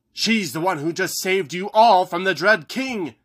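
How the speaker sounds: high, urgent shout; Haggerty shouting from the pool. Acted. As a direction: shout them down in her defence. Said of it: "Haggerty shouted".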